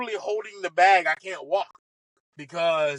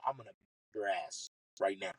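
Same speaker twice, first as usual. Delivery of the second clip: nearly silent, much lower and softer; mouthed threat; 12 semitones lower, 12 dB quieter than usual. Retold or imitated. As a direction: mouth the threat across the green. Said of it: "I mouth to him".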